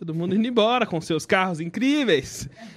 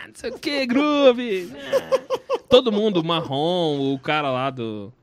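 normal talking voice

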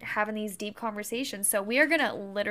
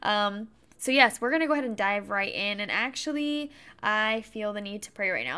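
ordinary talk, nothing marked

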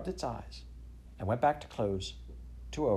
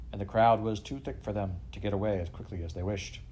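ordinary delivery, nothing marked

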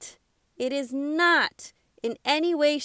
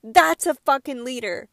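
louder, swearing with real passion; emotional outburst; 6 dB louder than usual. Real, not acted. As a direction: declare it with fire. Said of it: "I get really emotional about stuff like this".

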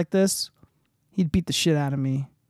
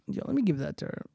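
ordinary talk

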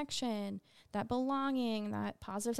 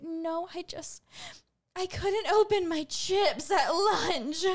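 put-on high, slow, tearful kid voice; distraught student; 8 semitones higher, 6 dB louder, slower than usual. Teacher imitating a student crying over unopened chips. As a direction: wail it like a heartbroken middle schooler. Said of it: "And she's like".

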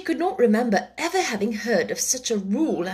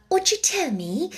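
timid; 9 semitones higher than usual. Acted. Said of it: "a little timidly".

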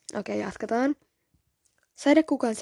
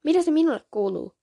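mildly angry, higher-pitched retort; defiant, raised question; hissed retort; 6 semitones higher, 3 dB louder than usual. A narrator's reading. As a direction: throw it back with a touch of scorn. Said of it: "sadekukka sähti".

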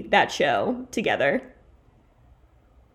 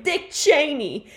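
drawn-out stage announcement; slow announcing voice; 6 semitones higher, slower than usual. Retold or imitated. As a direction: announce the name slowly. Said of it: "they're like".

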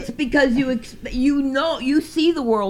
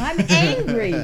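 Scrappy Doo voice; 2 semitones higher than usual. Pretend. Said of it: "I just made it sound like the dog".

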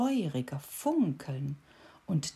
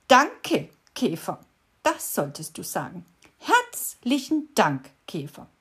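beetle correcting; 3 semitones higher, 7 dB louder than usual. A narrator's reading. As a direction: correct her, pushing the thanks.

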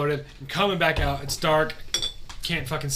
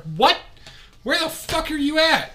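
much higher, startled retold demand; incredulous dad; 11 semitones higher, 4 dB louder than usual. Dad on the phone hearing he's still out, incredulous. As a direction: demand it in disbelief, pitch jumping up. Dad's startled reply, acted out.

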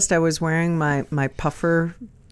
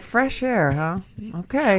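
skeptical; 3 semitones higher, 6 dB quieter than usual. Retold or imitated. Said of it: "I'm like".

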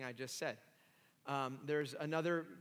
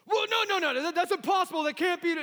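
much higher and louder, frantic denial; loud protest; 14 semitones higher, 12 dB louder than usual. Retold or imitated. Young Sean protesting to his mentor Harold, frantic denial. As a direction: refuse the idea outright, panicked.

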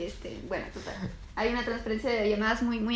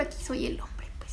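joking whispered aside; 8 dB quieter than usual. Acted. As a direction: whisper it as a cheeky joke. Joking aside about roles.

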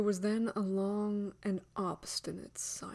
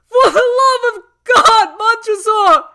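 very high, very loud plea; full-voiced shriek of terror; desperate scream; 23 dB louder than usual. A narrator's reading. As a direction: scream it out as a man begging for life. Walled-in man begs for life.

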